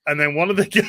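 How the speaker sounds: ordinary talk, nothing marked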